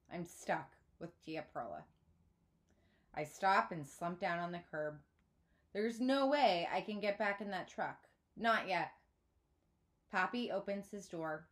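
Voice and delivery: ordinary delivery, nothing marked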